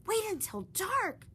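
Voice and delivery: bubbling anticipation; high keen promise; excited. Acted. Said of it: "said excitedly".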